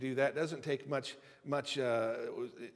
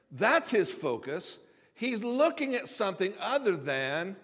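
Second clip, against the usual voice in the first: fiery preaching; 4 semitones higher, 6 dB louder than usual. Acted. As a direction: preach it with rising fervor. Preaching at full force.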